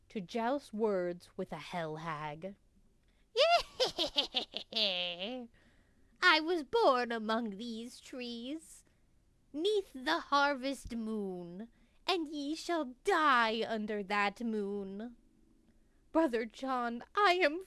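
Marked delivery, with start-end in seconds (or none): 3.3-5.4 wicked witch cackle. Acted. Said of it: "got that witch laugh pretty well". 6.2-15.2 put-on creaky old witch cursing travelers; old hag. Witch threatens the brothers.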